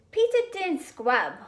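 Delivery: put-on a small child mangling his words; little kid voice. A narrator's reading. Little brother voiced in story.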